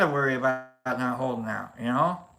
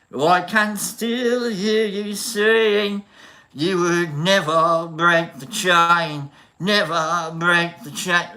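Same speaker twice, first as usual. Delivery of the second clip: put-on slow, louder British-accent singing; 4 dB louder, slower than usual. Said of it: "let me try, like, a British".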